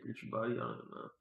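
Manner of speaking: ordinary talk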